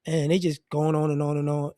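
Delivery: normal talking voice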